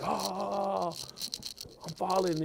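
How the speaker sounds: high, loud mocking whine; hammed-up falling cry; mock panic. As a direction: parody someone falling in fear. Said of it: "She probably be like".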